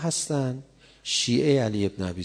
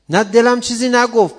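loud denial; 12 semitones higher, 12 dB louder than usual. Acted. Answering in a voiced dialogue.

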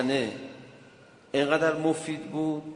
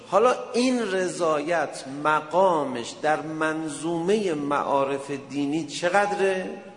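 louder, slow sermon delivery; slow emphatic preaching; 4 semitones higher, 4 dB louder, slower than usual. Acted. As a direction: drive the question home from the pulpit. (موعظهٔ کند و پرتأکید)

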